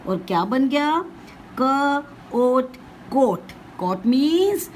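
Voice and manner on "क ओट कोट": क, ओट slowly, then कोट; phonics blending. Shown as a demonstration. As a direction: join क and ओट into कोट. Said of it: "क्या लगा दोगे एंड में". (क और ओट जोड़कर कोट)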